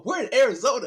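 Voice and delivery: high-pitched imitated heckler; voice from the back. Quoting a funny line from the bank skit, imitated bystander calling out. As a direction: pipe up high like someone in the background. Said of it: "somebody in the back go".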